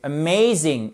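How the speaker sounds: "Amazing" as uh-MAY-zing; stressed second syllable. Shown as a demonstration. Teacher showing the stressed syllable, "Amazing" with strong MAY. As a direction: punch MAY in "Amazing", schwa first. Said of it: "c'est la syllabe accentuée".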